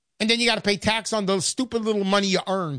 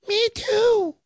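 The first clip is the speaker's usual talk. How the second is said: quick, much higher squeak; a little voice popping up from the safe; tiny Uncle Sam voice; 13 semitones higher than usual. Retold or imitated. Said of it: "Me too is locked out".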